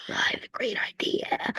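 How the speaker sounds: soft conspiratorial whisper of a scheme. Acted. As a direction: hush it like a secret plan. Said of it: "I don't know why I said it like that".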